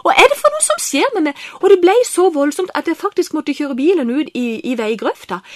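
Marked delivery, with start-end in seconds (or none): 0.0-1.5 much higher and louder, rushed and baffled; bewildered outburst. Retold or imitated. To himself in the car, bewildered and overwhelmed. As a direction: blurt it out, stunned by your own tears. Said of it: "For jeg tenkte".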